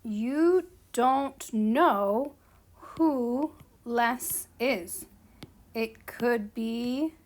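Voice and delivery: very slow, word by word; stretched words with pauses between; slow, halting explanation. Real, not acted. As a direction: talk slowly as if writing along. Talking slowly while writing.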